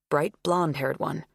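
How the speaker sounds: plain reading voice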